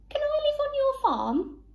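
very high-voiced, hopeful little rabbit; pleading rabbit. A narrator's reading. Rabbit asks duck for a home, high pleading. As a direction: ask sweetly, pitched right up.

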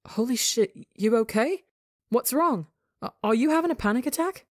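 scared partner checking in fast; alarmed. A narrator's reading. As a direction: ask fast in sudden alarm.

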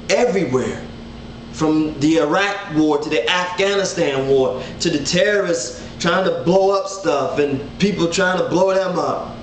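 ordinary talk, nothing marked

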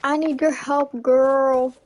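ordinary talk

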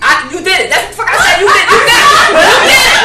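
loud, high repeated accusation; mock yelled accusation; shouting. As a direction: hammer him with it. Yelling accusation at husband.

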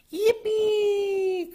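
high, loud squeal of glee; excited cry. A narrator's reading. Froggy cheers at the baseball game, high joyful cry. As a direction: cheer it out at the top of your range. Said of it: "cried Froggy".

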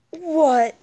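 loud startled what; shocked shout. Real, not acted. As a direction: bark it out in surprise. Sudden shock in game.

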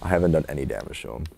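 usual speaking voice

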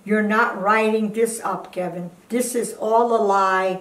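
ordinary talk, nothing marked

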